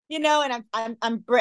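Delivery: ordinary delivery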